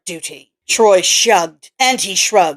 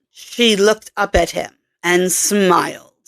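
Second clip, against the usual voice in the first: ordinary delivery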